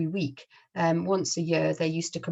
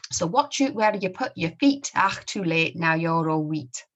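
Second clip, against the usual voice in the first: put-on comic-strip rhyme in a Scottish accent; 4 dB louder than usual. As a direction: read it out in broad Scots. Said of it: "I'll try my best to read them using the Scottish accent".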